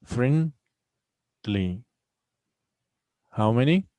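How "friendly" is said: "Friendly" split by a long gap; syllable by syllable. Shown as a demonstration. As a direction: pause between "friend" and "ly" in "Friendly". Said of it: "how many v-, syllables here".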